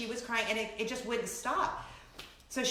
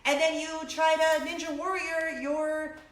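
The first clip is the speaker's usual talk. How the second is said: ordinary talk, nothing marked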